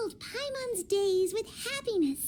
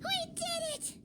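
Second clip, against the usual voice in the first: cheer; 10 semitones higher, 6 dB quieter than usual. Acted. Cheering after winning the battle.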